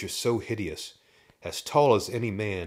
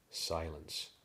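usual speaking voice

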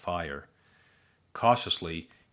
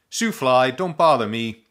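louder, swatting annoyance; shooing; 4 semitones higher, 8 dB louder than usual. Acted. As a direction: shoo it off loudly. Shooing the buzzing bug.

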